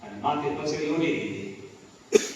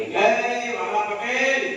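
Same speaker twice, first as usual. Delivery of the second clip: calling out; 7 semitones higher, much slower than usual. Acted. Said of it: "ભગવાને બૂમ પાડી".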